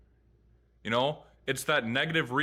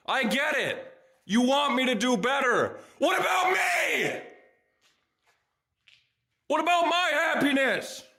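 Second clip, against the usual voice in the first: high, loud pleading yell; real anguished shout; 11 semitones higher, 4 dB louder than usual. Real, not acted. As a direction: cry out at your parents. Ranting at parents about happiness.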